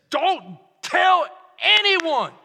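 slow, loud, drawn out; emphatic shout. Real, not acted. Preacher driving the point home, slow shout. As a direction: shout each word out slowly.

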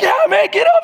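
high, loud friendly cheer. Retold or imitated. Friend cheering the fallen skier to get up, high loud call. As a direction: urge your buddy up.

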